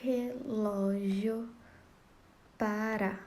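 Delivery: Relógio para drawn out very slowly; scanning syllables. Shown as a demonstration. Teacher separates the verse's syllables, very slowly. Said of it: "vamos fazer aqui o, a separação".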